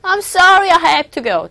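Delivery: ordinary talk, nothing marked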